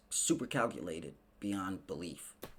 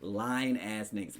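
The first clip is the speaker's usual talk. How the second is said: normal talking voice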